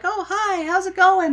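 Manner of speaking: very high, sing-song mock hello; mock cheery greeting. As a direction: chirp it way up high. [name] and [name] greeting Farley.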